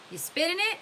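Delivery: put-on high, loud jeering kid; teasing boy. Squid jeering at the worn-out Stanley, high and loud. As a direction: poke fun from up high.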